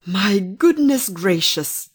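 slow, loud amazement; astonished gentleman. Acted. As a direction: draw it out in astonishment. Gentleman sees the phoenix emerge.

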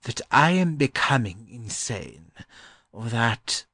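mildly slowed musing; slowly. A narrator's reading. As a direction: ponder haltingly as a scholar. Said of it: "he said slowly".

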